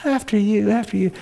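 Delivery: hint of an eager boyfriend holding the door; polite gentleman. Young suitor to his date, lightly mock-gallant. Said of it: "he would always open the door for her".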